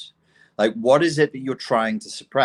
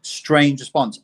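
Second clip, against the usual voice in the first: usual speaking voice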